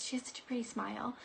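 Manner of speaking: unvoiced fast whisper. Real, not acted. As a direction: hush in awe. Hushed vision of girl.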